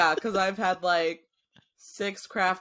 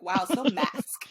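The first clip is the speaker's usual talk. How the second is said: mock bro voice; 2 semitones higher, slower than usual. Mocking a bro.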